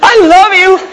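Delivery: high, slow adoring wife voice; a wife's dreamy welcome home, pitched high; doting wife. Pretend. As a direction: greet him like she's been waiting all day. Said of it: "Just kinda walk in the door and".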